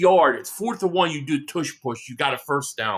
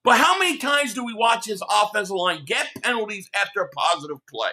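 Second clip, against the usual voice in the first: ordinary talk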